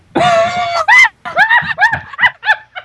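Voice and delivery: high loud burst of laughter; shrieking laugh. Real, not acted. Streamer bursts out laughing at the chat.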